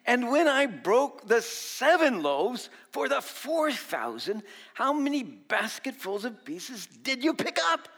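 slow, high, loud rebuke; Jesus rebuking his disciples, slow and loud. Retold or imitated. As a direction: drag out each question in dismay. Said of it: "they replied".

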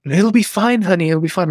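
high, gentle imitation of his wife's comfort; wife reassuring. Wife comforting upset husband, reassuring high voice. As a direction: soothe him like a calm wife, pitched high. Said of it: "And she's like".

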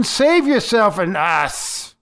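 a mildly acted taunt, drawn out at first; hurled insult. Crucified criminal railing against Jesus, mildly insulting. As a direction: throw it as an insult, with some restraint. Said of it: "throwing insults in his direction".

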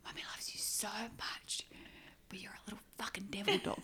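breathy whisper, lovingly teasing. Shown as a demonstration. Owner whispering into her puppy's ear, teasing. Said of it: "Whisper in her ear".

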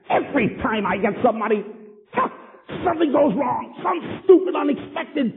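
high, whining mock complaint, rising as it goes; whiny complainer. Preacher mimicking people griping about money, in a high whine. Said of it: "I've heard people make statements like this".

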